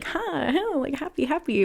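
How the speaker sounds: high breathy giggly "Huh, huh"; creepy happy kid. Retold or imitated. Brother's creepy roommate, mock giggly cheer. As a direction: mimic a fake-happy boy. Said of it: "He always was like".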